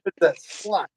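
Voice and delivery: high cartoon echo; dopey movie impression; Beavis. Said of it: "Oh, look, butthead".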